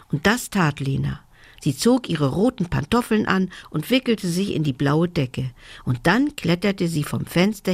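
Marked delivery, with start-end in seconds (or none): none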